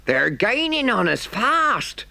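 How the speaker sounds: put-on high, drawn-out cry in a rustic accent; alarmed cry. A narrator's reading. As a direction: engine driver crying out a warning. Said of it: "cried the engine driver".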